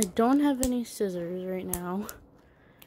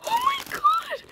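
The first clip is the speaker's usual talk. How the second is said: a sudden shriek, far higher and louder; excited shriek; 4 dB louder than usual. Real, not acted. Girl opening the toy she wanted, shrieking with excitement. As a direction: squeal at finding the one you wanted. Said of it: "I'm freaking out right now".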